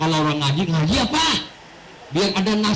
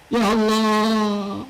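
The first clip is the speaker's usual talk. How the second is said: ordinary talk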